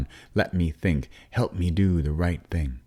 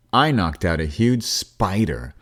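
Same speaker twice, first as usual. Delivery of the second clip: huge drawn out and pushed louder; a slow, proud huge, louder than the rest; stress on huge; 4 semitones higher, 5 dB louder, slower than usual. A narrator's reading. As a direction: lean hard on the word huge. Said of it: "emphasizing the word huge".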